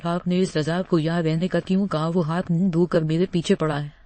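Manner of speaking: usual speaking voice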